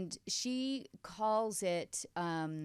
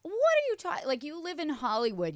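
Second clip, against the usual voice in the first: incredulous; 9 semitones higher, 5 dB louder than usual. Real, not acted. Disbelief at friend.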